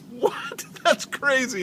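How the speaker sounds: high, loud incredulous outburst; shocked disbelief. Retold or imitated. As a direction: blurt it out stunned. Shocked by the bouncer's remark.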